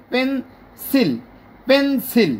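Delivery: "Pencil" in two separate pushes, pen, sil; split into syllables. Shown as a demonstration. Teacher showing learners two syllables, "pencil" split in two. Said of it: "নতুন করে আবার শুরু করতে হয়েছে".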